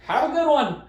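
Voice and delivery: high, loud retold farewell; calling out. Trump driving away from the golfers, calling out. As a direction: holler a cheery goodbye from afar.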